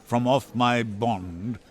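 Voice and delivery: put-on looking down on the young man, slow and cold; scornful Shylock. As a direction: Shylock answering Gratiano's insults, low and scornful. Shylock mocks Gratiano's ranting.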